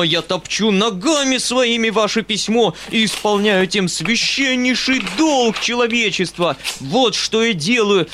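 high, ranting outburst; flaring into fury. A narrator's reading. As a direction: explode and hammer out each exclamation. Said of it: "мгновенно воспламеняясь".

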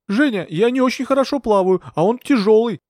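high-pitched anxious whine; complaining. A narrator's reading. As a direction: complain helplessly to the rescuer. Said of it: "пожаловался Скоробогатов".

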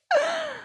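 short high-pitched sob; tearful sob. Acted. Woman ends her accusation to the king, sobbing.

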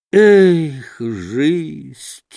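heartfelt sigh. A narrator's reading. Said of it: "Вздохнул мужик от всего сердца". (тяжкий вздох от всего сердца)

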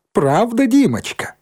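very high, cloying question; high, mock-sweet lilt; singsong teasing. A narrator's reading. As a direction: needle the boy in a lilting voice. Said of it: "пропела Шмакова".

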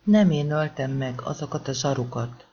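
ordinary delivery

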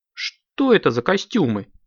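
indignant. A narrator's reading. Director to actors in wrong costumes, mildly indignant. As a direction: demand an explanation, voice jumping up. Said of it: "возмутился он".

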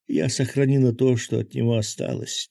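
ordinary delivery

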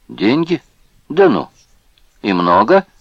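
high, rising scornful questions; sarcastic astonishment, pitch leaping up; mocking surprise. A narrator's reading. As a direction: react to the offer with loud, derisive amazement. Boss mocks offer of money.

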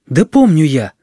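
put-on boy's quick exclamation; exclaiming. A narrator's reading. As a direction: burst out that you do know. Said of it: "воскликнул Серёжа".

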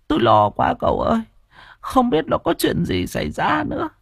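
fretful grandmother telling the news; worried grandmother. Acted. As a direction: pour out the worry to the young man.

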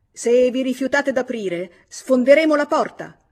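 husband yelling a threat to break in; furious threat. A narrator's reading. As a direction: threaten to smash the door in. George threatens to break door.

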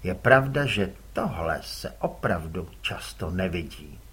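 ordinary delivery

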